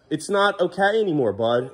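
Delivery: normal talking voice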